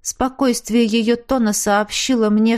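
plain reading voice

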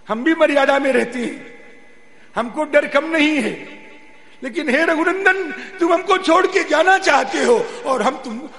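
loud, high, insistent protest; children's impassioned plea. Acted. Children begging Raghunandan not to leave them, loud impassioned plea. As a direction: plead at full voice, pitch pushed up. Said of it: "बालकों ने कहा कि".

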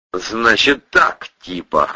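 very slow drawled words; drawling. Acted. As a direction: stretch it out lazily. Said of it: "протянул Атасов".

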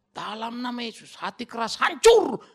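slow, deliberate prayer rising to a loud HANCUR; slow pompous rebuke, punching "hancur" loud; mock rebuke prayer. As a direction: pronounce it like a hammer blow, slowly. Acting out a heavy-handed rebuke prayer.

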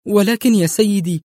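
high, nervous stammering; a timid nurse caught off guard; anxious, halting plea; flustered protest. A narrator's reading. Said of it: "ارتبكت الممرضة وهي تقول".

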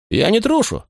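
high, outraged denial; indignant protest. A narrator's reading. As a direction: fling back the insult. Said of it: "возмутился Фигул".

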